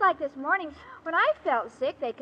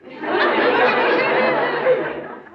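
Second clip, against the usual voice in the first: a long giggle, higher and louder; giggling laugh; 6 semitones higher, 6 dB louder than usual. Acted. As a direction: giggle pleased with the joke. Irma laughs at her pun.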